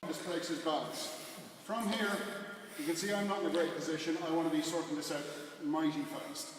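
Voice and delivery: normal talking voice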